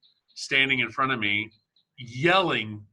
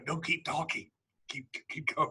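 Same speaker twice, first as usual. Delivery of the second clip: far softer, gentle keep-going; hushed coaxing inner voice; soft encouraging voice; 3 semitones higher, 8 dB quieter than usual. Retold or imitated. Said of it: "your voice in my head going".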